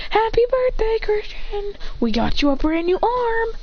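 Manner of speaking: high, excited group greeting; birthday cheer. Acted. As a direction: announce the gift in a high voice. Phantoms voiced giving birthday surprise.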